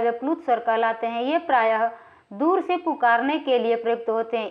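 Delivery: ordinary talk, nothing marked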